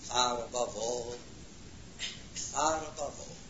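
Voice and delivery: soft, awed murmur; hushed awe. Real, not acted. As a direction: let it fall away in wonder. Awed repetition after pointing upward.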